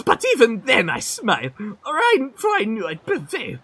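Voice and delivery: grand, boastful storyteller voice; hammy braggart spinning a tale; boastful merchant. Acted.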